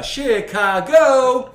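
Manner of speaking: high, loud, very stretched CHICAGO; drawn-out catchphrase yell. Pretend. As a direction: holler Chicago and hold the last syllable. Drags out a film catchword.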